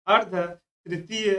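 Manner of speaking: अर्ध तृतीय drawn out slowly, part by part; slow dictation. Shown as a demonstration. Teacher giving a term students confuse, slow dictation.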